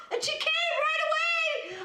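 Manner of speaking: very high, slow, squealing with laughter; laughing shout. Real, not acted. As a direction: tell it at a squeal, cracking up. Teller laughing, still at yelling pitch.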